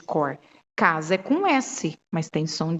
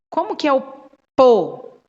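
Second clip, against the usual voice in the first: po punched out loud at the end of the question; teacher asking the child for the next syllable; teacher prompting child; 6 semitones higher, 6 dB louder than usual. Shown as a demonstration. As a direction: lean hard on po like coaxing a child. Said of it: "você fala, "Olha".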